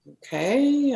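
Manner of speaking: hesitant, unsure what to make of it; puzzled okay. Retold or imitated. Healer baffled by a word she heard, puzzled.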